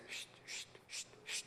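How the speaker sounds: hushed, repeated shushing noise; quiet, voiceless scuffling sound; imitated rustling. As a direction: make soft creature noises outside a tent. Imitates the sound outside the tent.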